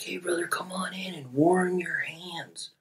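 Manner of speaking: high, hushed call-over; half-whispered mock invitation; beckoning. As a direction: imitate strangers softly calling someone in. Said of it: "flagging me in".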